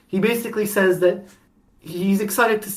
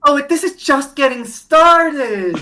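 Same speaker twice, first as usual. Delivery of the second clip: slow, drawn-out and higher, relishing the reveal; ominous teasing; 8 semitones higher, 5 dB louder, slower than usual. Real, not acted. Host teasing his cohost that it gets crazier, slow and ominous. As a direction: savour it like a villain with a secret.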